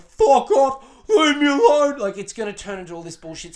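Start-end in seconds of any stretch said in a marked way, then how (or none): 0.0-2.0 loud, high angry yell; mock freak-out. Boyfriend freaking out at his emotional girlfriend, mock angry shout. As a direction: explode like a fed-up boyfriend. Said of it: "he's gonna freak out and be like".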